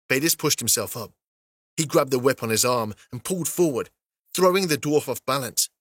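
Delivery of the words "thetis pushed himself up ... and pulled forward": ordinary delivery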